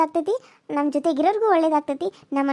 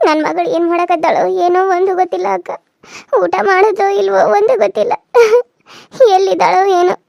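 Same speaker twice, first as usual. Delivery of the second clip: crying worry; 3 semitones higher, 6 dB louder than usual. Acted. Mother frets over missing daughter.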